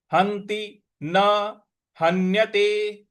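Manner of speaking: "हन्यते" chanted with a held n; stressed half n. Shown as a demonstration. Teacher models the stress in "हन्यते", chanted. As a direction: press on the n in "हंति" and "हन्यते". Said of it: "आघात देना है".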